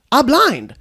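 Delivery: normal talking voice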